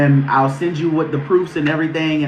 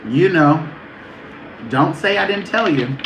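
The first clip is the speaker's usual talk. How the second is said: ordinary delivery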